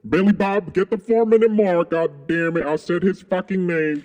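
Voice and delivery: high, loud cursing; frustrated yell. Real, not acted. Yelling at Billy Bob after saying the name, loud and high. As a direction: yell at yourself for the slip.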